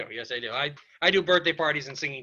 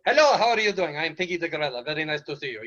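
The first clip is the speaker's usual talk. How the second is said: hammed-up, vague foreign accent; Martin Short impression. Shown as a demonstration. Telegram singer greeting customers, hammed-up made-up accent. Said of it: "my best impression of Martin Short".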